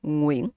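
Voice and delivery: "Nguyen" begun with back-of-mouth ng; initial NG sound. Shown as a demonstration. Teacher showing the word, ng-initial "Nguyen". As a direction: open "Nguyen" on ng, not n. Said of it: "It start with a ng sound".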